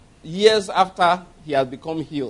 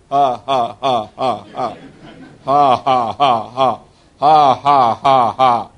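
low, measured ha-ha, swelling louder; imitated dry laugh; 7 semitones lower, 4 dB louder, much slower than usual. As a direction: laugh mockingly on purpose, syllable by syllable. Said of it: "He said the laughter sounded very dry".

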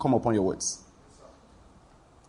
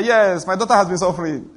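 a put-on fake-sufferer voice, a bit louder; mock mother's plea; 2 semitones higher, 4 dB louder than usual. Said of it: "Sick people don't talk like that".

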